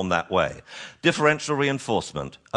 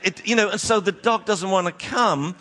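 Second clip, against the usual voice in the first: ordinary talk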